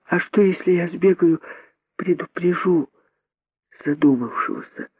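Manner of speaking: put-on high, hushed child's voice; soft, tentative high-pitched youngster; little monkey daughter. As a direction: suggest it timidly in a small voice. Daughter timidly proposes warning someone.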